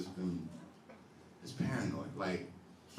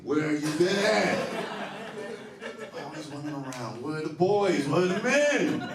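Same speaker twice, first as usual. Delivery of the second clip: mock outrage; 9 semitones higher, 7 dB louder than usual. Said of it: "I'm like".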